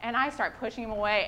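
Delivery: normal talking voice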